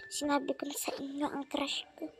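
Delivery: soft whispered confession. Real, not acted. Vlogger whispering her crush confession to viewers. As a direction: whisper it like a secret.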